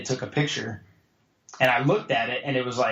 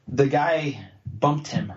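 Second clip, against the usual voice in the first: very slow, spaced-out retold line; slow explanation; much slower than usual. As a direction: spell it out slowly for someone not getting it. Girlfriend explains to confused boyfriend.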